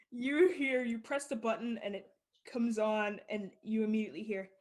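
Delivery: ordinary talk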